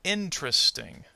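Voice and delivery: "Interesting." said as IN-tres-ting; dropped middle syllable. Shown as a demonstration. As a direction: demonstrate "Interesting." in three syllables. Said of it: "Let's demonstrate".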